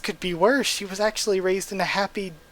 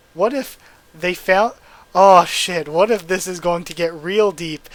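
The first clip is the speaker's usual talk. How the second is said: loud, rising excitement that bursts out; sudden realisation; 8 dB louder than usual. Real, not acted. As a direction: reader suddenly sees a twist, excited, louder. Reader realises a plot twist.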